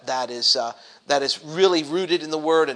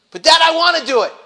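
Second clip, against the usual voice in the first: put-on insistent child; 11 semitones higher, 9 dB louder, faster than usual.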